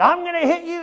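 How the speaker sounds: high mock threat; a hammed-up drunk's threat; drunk guy. Quoting drunk guy's threat.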